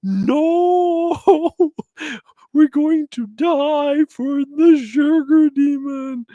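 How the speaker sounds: over-the-top fake fright with laughter; mock terror. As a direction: pretend to be terrified of a silly demon. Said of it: "You're like".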